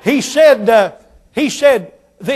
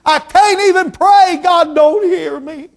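high loud slow lament; despairing cry; 7 semitones higher, 5 dB louder, slower than usual. Retold or imitated. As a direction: cry out that God won't hear. Man in storm of life.

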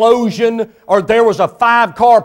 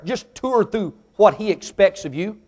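normal talking voice

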